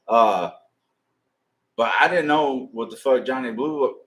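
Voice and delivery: ordinary delivery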